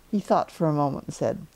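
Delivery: ordinary delivery, nothing marked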